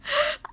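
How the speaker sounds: sharp, high gasp of astonishment; excited gasp. Retold or imitated. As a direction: gasp in mock shock before squealing. Retold reaction to seeing the post.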